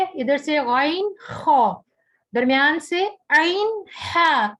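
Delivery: "غین خا" from the upper throat, "عین ہا" from mid-throat, slowly; throat letters slowly. Shown as a demonstration. Teacher slowly showing students the three throat sounds. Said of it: "حلق کے تین حصے ہیں".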